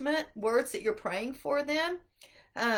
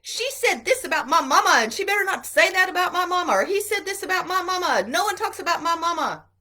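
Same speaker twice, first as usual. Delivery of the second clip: imitating angry kids, louder and higher; mock playground quarrel; 6 semitones higher, 8 dB louder, faster than usual. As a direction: play a fifth grader defending mama, heated.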